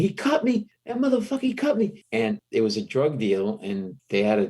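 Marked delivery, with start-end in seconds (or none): 0.0-2.0 high-pitched imitation of a screaming victim; retold scream, high but not full volume; mock screaming. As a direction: act out an outraged cry, pitched way up. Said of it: "he starts screaming".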